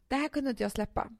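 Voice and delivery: ordinary talk, nothing marked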